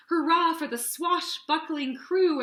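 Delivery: cheering. Acted. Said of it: "everyone cheered".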